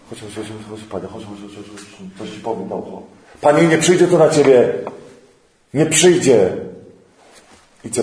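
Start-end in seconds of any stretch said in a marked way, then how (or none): 0.1-3.0 soft, confidential beckoning; hushed aside. Acted. Peter pulls Jesus aside quietly. 3.4-6.5 louder, pressing the point; insistent protest. Acted. Peter protesting to Jesus.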